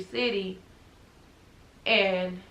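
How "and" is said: ordinary delivery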